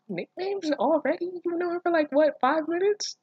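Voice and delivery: high, incredulous jab; mocking disbelief. Real, not acted. Reader scoffs at the instant nickname.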